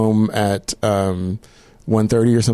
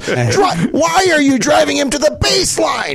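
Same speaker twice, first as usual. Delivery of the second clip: high, loud, slow rant yell; yelling YouTuber; 3 dB louder, slower than usual. Retold or imitated. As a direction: rant at the player at full volume. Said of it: "And he just screams".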